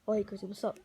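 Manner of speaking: usual speaking voice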